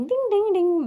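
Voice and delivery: high sing-song ding ding ding; bell jingle. Retold or imitated. Vlogger imitating the dance-class bells, high and sing-song. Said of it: "dance bells imitation".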